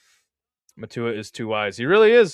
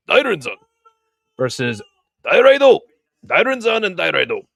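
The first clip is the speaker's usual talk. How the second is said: hammed-up Japanese accent, announcer style, higher and louder; bad Japanese accent; 6 semitones higher, 8 dB louder than usual. Streamer announcing next wrestlers to chat, bad Japanese accent. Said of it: "my bad attempt at a Japanese accent".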